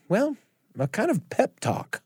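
ordinary talk

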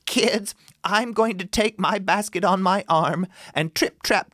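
put-on high motherly goat voice, warning her kids; mama goat voice. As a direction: sound like a fussy mama goat. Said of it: "the mama goat said to her kids".

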